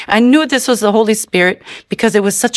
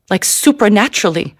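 usual speaking voice

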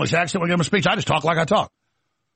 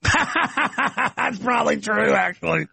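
talking through a drawn-out laugh; 4 semitones higher, much slower than usual. Real, not acted. Cracking up at their own alien story, laughing. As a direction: crack up while admitting it.

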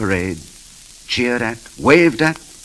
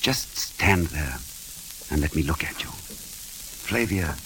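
tender; 5 semitones lower, 10 dB quieter, much slower than usual. Acted. Tender moment alone with Flavia.

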